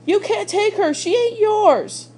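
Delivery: put-on boy screaming at his father over his sister's body. A narrator's reading. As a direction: scream it with everything. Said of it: "Bryce screamed".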